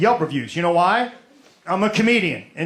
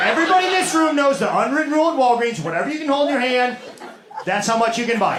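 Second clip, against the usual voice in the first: fast rant; 4 semitones higher, faster than usual. Real, not acted. Ranting about Walgreens rule.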